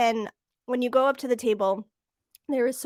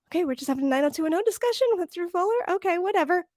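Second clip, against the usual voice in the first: higher, hurried mock acceptance; bemused going along; 7 semitones higher, faster than usual. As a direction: play at shrugging off something absurd. Acts out bemused acceptance of chat.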